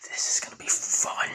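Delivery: whispered villain glee; smiling villain. Acted. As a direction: whisper it with sinister relish. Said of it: "he just smiles saying".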